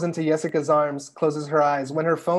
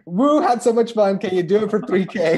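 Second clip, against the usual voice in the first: loud, bright whoop into a cheeky ask; gush, then slyly up the price; a giddy reply to a job offer; excited text; 2 semitones higher, 6 dB louder than usual. Acted.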